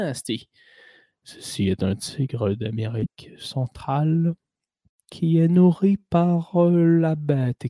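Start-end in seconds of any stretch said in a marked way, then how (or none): 1.2-7.7 slow, solemn documentary voice; nature documentary narrator. Retold or imitated. Host imitating a tiger documentary narrator, slow and solemn. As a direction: drag out each word like a wildlife narrator. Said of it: "quand il nous parle de tigre".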